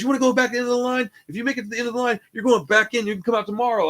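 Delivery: high-pitched bullying threat; retold screaming. Cop threatens to send man back in line, mock screaming. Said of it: "he screams at me".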